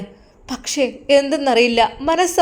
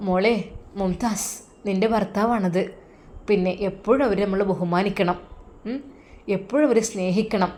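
low, soft motherly advice; a hint of a gentle mother, lower and softer; mother's voice; 4 semitones lower, 6 dB quieter than usual. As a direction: counsel her daughter quietly.

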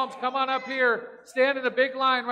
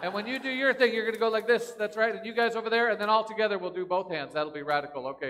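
ordinary talk

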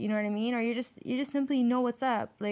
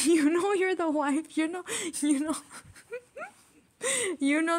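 laughing; 7 semitones higher than usual. Real, not acted. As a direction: crack up while repeating it. Repeat bursts into laughter.

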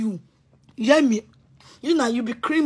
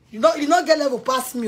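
ordinary delivery

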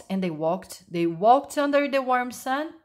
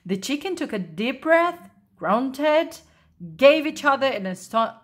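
ordinary delivery, nothing marked

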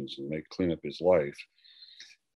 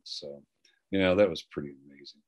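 ordinary delivery

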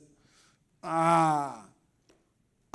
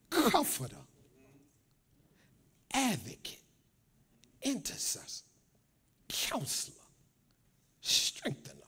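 hushed naming; 3 semitones higher, 12 dB quieter than usual. Real, not acted. Names of the Spirit, hushed.

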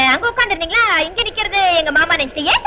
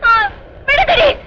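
high, drawn-out cries for help; slow, high wailing pleas; desperate calling out; 8 semitones higher, much slower than usual. Acted. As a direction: call out as if being held. Woman struggling, calling for help.

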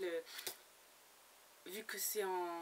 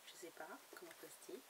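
whispered aside; 3 semitones lower, 13 dB quieter, faster than usual. Real, not acted. Unsure aside after a name.

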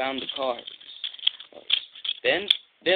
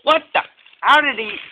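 loud, high sputtering disbelief; baffled outburst; 11 semitones higher, 8 dB louder than usual. Acted. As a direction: blurt it out, utterly bewildered. Diesel baffled by the twins.

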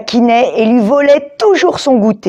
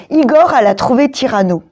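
usual speaking voice

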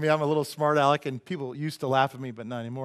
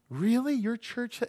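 high-pitched incredulous questioning; mock disbelief; 8 semitones higher than usual. Churchgoer reacting to the pastor's admission, mock disbelief. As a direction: imitate a scandalized churchgoer, pitched up.

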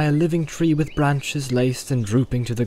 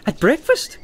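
high-pitched, scandalised question; amazed, voice pitched up; incredulous; 12 semitones higher, 4 dB louder than usual. Acted. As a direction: ask it in comic disbelief. Pippin incredulous at thinking so early.

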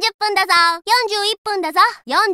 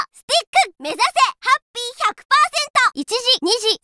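ordinary talk, nothing marked

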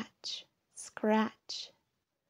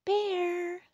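high, drawn-out call; crying out; 11 semitones higher than usual. A narrator's reading. Ladybug cries to snoring Bear, calling out high. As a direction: sing out the name, pitched way up. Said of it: "cried Ladybug".

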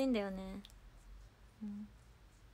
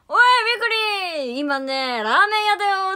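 high, hearty holler; retold loud call; 9 semitones higher, 13 dB louder than usual. Performer announcing from a ramen shop, imitated loud shout. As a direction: imitate someone yelling excitedly across a shop. Quotes someone calling out on stream.

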